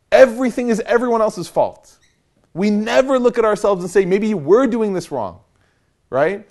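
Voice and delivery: fiery preaching. Acted. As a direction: rebuke the community with fire. Preaching at full force to congregation.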